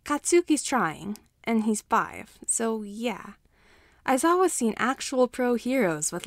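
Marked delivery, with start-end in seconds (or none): none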